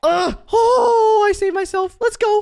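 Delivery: very high, loud celebration; real adrenaline-rush cheer; thrilled relief. Real, not acted. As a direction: yell after a narrow save. Narrow save in the game.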